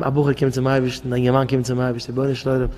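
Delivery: a broken man begging God in a low, soft voice; pleading poor man. Retold or imitated. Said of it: "Stell dich vor".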